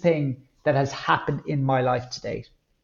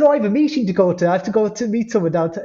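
hurried excuse; 7 semitones higher, 5 dB louder, faster than usual. Retold or imitated.

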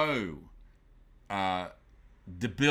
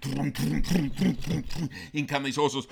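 slow, loud, higher storytelling; preacher narrating the cavalry charge, loud and slow; dramatic build; 5 semitones higher, 5 dB louder, much slower than usual. A narrator's reading.

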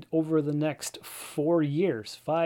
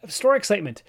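bursting with store excitement, pitched up and loud; eager, raised voice; excited; 10 semitones higher, 7 dB louder than usual. Real, not acted. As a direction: announce it like big news. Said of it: "I'm very excited to start up this store".

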